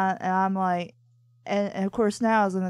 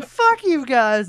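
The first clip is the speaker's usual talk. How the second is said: slow, loud, bratty "fuck you guys"; cocky cartoon kid; 3 semitones higher, 8 dB louder, slower than usual. Imitating a cartoon boy flipping off the villain, slow and loud. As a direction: drag it out like a smart-aleck boy taunting the villain. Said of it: "eleven-year-old boy in the nineties on T V going".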